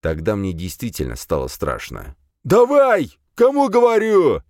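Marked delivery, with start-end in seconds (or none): 2.4-4.4 high, loud angry shout; furious gunman's bellow; barked order. A narrator's reading. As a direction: yell impatiently at someone stalling. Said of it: "рявкнул мужчина".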